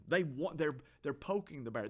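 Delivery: ordinary talk, nothing marked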